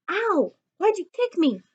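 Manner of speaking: yelp, then protest the kick; yelp of pain. Acted. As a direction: George yelping after a kick from his friend. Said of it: "he asks".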